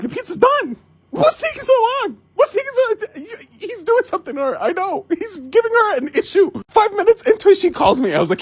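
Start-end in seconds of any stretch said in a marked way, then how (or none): 0.0-6.5 anxious inner monologue. Retold or imitated. Imagining anxious inner thoughts.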